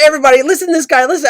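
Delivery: rally everyone to listen, quickly; calling out excitedly. Retold or imitated. Worker calling coworkers over to hear the caller, excited.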